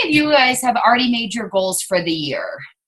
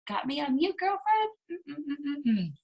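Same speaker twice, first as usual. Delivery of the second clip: much softer tsk-tsk; quiet teasing scold; mock disapproval; 4 semitones higher, 12 dB quieter, slower than usual. Real, not acted. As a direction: hum your disapproval under breath.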